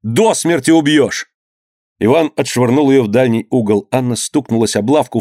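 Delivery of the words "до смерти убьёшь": higher, slower cry of alarm. A narrator's reading. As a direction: cry out to stop the beating. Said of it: "кричала Анна". (тревожный крик)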